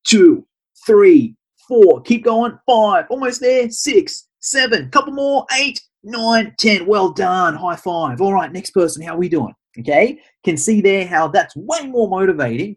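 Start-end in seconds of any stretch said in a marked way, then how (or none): 0.1-6.5 loud, high counting out reps. Shown as a demonstration. Counting push-ups loudly for client. 6.6-9.5 upbeat one-on-one coaching, higher voice; encouraging trainer. Shown as a demonstration. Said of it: "walk around to every single person".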